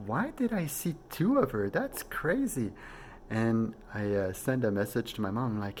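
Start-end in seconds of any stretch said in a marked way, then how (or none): 0.1-2.7 much higher, astonished musing; just woke, baffled by the dream; amazed wonder. Retold or imitated. Wakes up amazed.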